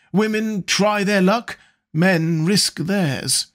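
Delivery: high, languid lord; drawling wit. A narrator's reading. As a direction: toss off the quip like a bored lord. Lord Henry's epigram on marriage.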